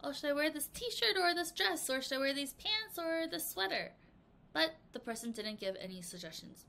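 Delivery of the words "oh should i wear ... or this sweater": higher voice, torn between outfits; undecided asker. Shown as a demonstration. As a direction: act out asking what to wear.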